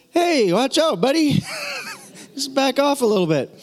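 high, joking warning; mock protest. Preacher jokingly objecting to being called old, high mock protest. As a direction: playfully warn him off in a high voice.

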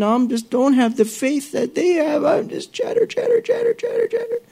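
very high squeaky whining self-pity; whiny chatterbox. Retold or imitated. Preacher voicing the listener's inner chatterbox, very high whiny. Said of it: "your little chatterbox is going on".

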